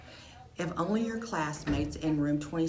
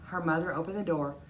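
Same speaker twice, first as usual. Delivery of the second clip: usual speaking voice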